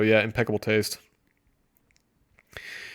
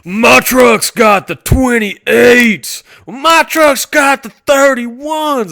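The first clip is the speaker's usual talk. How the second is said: loud, slow, half-sung boast, much higher; bragging truck guy; 10 semitones higher, 9 dB louder, much slower than usual. Retold or imitated. Mocking modern truck owners bragging about rims, loud sing-song. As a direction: belt it out like a show-off with huge rims. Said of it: "Now it's like".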